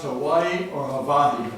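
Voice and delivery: first "Hawaii" with W, second "Hawaii" with V; contrasting W and V. Acted. Husband asks an elderly man, "Hawaii" first with W, then with V. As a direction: set "Ha-WAI-ee" against "Ha-VAH-ee", slowly. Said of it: "debating on the proper pronunciation of Hawaii".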